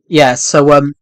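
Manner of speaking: usual speaking voice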